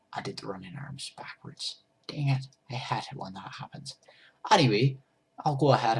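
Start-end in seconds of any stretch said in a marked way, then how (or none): 0.1-4.0 soft unvoiced whisper to herself; hushed self-correction; whispered aside. Real, not acted. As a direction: whisper it under your breath. Muttering a mistake to herself.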